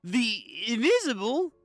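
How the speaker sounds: high, rising nervous disbelief; panicked. Acted. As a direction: panic as the hunter stares right at you. Hidden character panicking.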